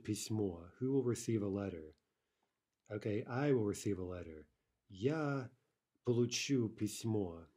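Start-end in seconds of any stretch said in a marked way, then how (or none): none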